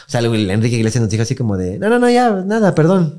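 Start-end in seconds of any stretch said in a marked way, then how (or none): 1.8-3.1 high flustered apology. Retold or imitated. [name] backing off.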